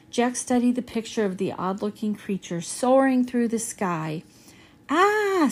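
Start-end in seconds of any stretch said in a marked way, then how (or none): 4.9-5.5 high startled scream, not very loud; a short sharp cry of fright. A narrator's reading. Girl at treehouse window startled, high scream. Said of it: "screamed Annie".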